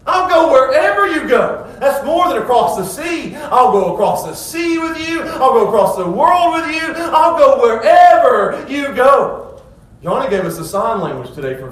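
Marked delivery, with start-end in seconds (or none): 0.1-1.7 high, loud, slow and emphatic; fired up. Retold or imitated. Scribe telling Jesus he's all in, fired-up shout. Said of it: "he's just so fired up". 3.3-9.6 high, loud, slow and emphatic; fired up. Retold or imitated. Scribe telling Jesus he's all in, fired-up shout. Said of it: "he's just so fired up".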